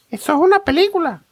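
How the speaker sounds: put-on very high-pitched child voice; niño respondón. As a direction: answer back as a squeaky little kid. (voz muy aguda de niño)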